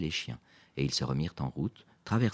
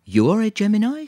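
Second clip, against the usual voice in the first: high, loud question with a smile; smiling question; 12 dB louder than usual. A narrator's reading. Lisa learns Quentin's star sign, smiling question. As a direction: ask it brightly through a smile, pitch right up. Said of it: "sourit Lisa".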